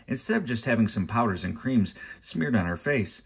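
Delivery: plain reading voice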